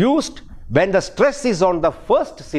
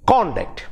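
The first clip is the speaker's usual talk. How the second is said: CONduct, stressed on the first syllable. Shown as a demonstration. Teacher showing how the noun is pronounced, first-syllable stress on conduct. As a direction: hit the CON of conduct, falling away after it. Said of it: "stress ... is on the first syllable".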